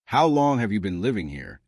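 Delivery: plain reading voice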